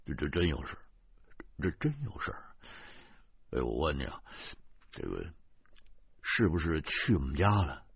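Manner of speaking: pained pleading. A narrator's reading. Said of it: "徐大光苦着脸说".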